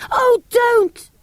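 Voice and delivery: sudden high-pitched protest; alarmed cry. A narrator's reading. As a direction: yelp it out in alarm. Samiad cries out in alarm.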